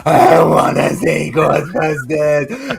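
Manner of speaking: hammed-up, drawn-out childish wail; sulking child imitation. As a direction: imitate an upset kid refusing, dragging the words out. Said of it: "I'm like that going".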